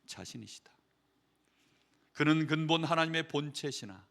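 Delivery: ordinary talk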